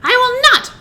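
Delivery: high, loud witch's refusal; defiant retort. A narrator's reading. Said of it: "retorted the witch".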